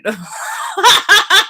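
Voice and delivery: loud, high burst of laughter; high cackling laugh; big laugh. Real, not acted. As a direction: crack up at your own joke. Laughs at her own scoffing.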